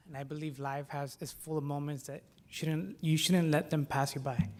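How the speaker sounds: usual speaking voice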